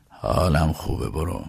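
slow, low, heavy reassurance; subdued. Acted. Grieving master sending his worried driver away, subdued. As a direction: let it sink low and soft at the end.

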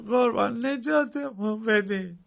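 high, slow begging cry. Acted. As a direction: plead for rescue, stretched out. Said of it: "با التماس گفتند".

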